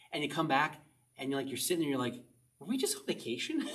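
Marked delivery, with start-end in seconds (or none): 2.6-3.7 dazed disbelief. Retold or imitated. Imitated dazed thought after vacation.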